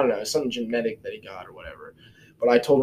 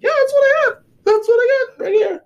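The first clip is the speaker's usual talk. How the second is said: squeaky mock voice, much higher and louder; 8 dB louder than usual. Joking to the pharmacist in a squeaky high voice. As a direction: pipe it up high like a cartoon. Pointing at his clot, mock voice.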